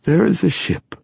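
high, aged character voice; old man wearily revealing a secret; weary old poet, pitched well up; tired old poet. A narrator's reading. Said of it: "said in a tired voice".

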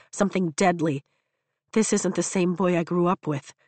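ordinary delivery, nothing marked

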